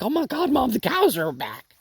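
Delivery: high, squeaky voicing of the excited dog; dog's excited voice. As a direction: speak for the dog, thrilled the cows are back. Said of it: "barking like".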